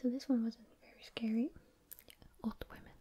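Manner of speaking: ordinary delivery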